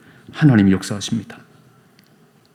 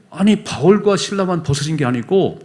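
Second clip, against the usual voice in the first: ordinary delivery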